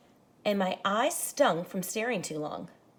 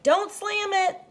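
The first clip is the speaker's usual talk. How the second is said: a higher, louder warning call; mock scold; 11 semitones higher, 6 dB louder, slower than usual. Someone in the house warns the boy about the door, mock scold. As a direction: snap it out as a parent would.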